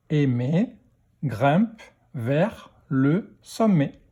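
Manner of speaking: slow, gaps after "Aimer", "grimpe", "vers", "le"; word-by-word reading. Shown as a demonstration. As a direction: read "grimpe vers le sommet" one word at a time. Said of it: "lecture de phrase".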